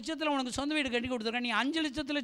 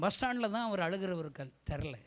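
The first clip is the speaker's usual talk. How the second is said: ordinary talk, nothing marked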